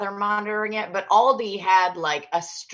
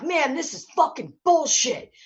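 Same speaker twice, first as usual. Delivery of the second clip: higher-pitched imitation of a fed-up shopper; mock pissed-off; 6 semitones higher than usual. Angry unmasked shopper complaining in the store, mock pissed-off. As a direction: grumble indignantly as the angry man. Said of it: "the guy was so fucking pissed".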